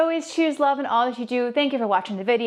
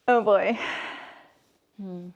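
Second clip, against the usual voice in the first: ordinary talk, nothing marked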